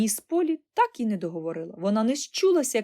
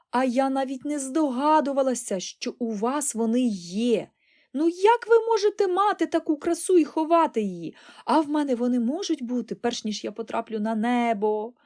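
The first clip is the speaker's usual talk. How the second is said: raised, higher voice brimming with enthusiasm; excited crying out; 5 semitones higher, 4 dB louder than usual. A narrator's reading. Girl circling round her aunt, crying out excitedly. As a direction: burst out in eager amazement. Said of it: "кричала вона".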